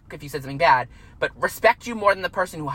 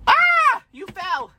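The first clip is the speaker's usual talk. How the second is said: a sudden very high yelp, then a gasp; startled shriek; 13 semitones higher, 4 dB louder, much slower than usual. Real, not acted. Startled when something falls mid-rant, high yelp. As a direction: react as if something just dropped.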